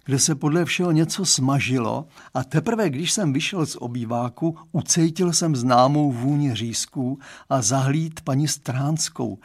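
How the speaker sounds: plain reading voice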